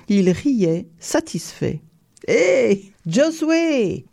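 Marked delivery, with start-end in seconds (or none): 2.3-4.1 high-pitched hail; calling out. A narrator's reading. Count hails Josué the poacher, calling out. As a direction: call the peasant over from afar. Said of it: "cria-t-il".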